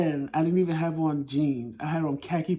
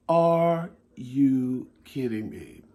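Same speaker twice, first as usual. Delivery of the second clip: slow disbelief; 2 semitones lower, much slower than usual. Retold or imitated. Stepping out into a blizzard.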